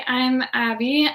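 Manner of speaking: normal talking voice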